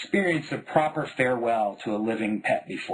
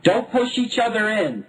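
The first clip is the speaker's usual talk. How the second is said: warning; 10 semitones higher, 4 dB louder than usual. A narrator's reading. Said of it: "Dad warned".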